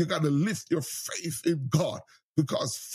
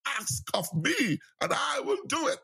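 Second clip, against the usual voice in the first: voice breaking; 9 semitones higher than usual. Acted. Preacher speaking as God to the congregation, tearful cry. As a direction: pitch climbs into a tearful cry.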